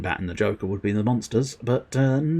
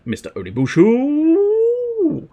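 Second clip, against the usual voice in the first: high, stretched call of the name; excited drawn-out greeting; slower than usual. Real, not acted. Streamer greeting a chat viewer, drawn-out and excited.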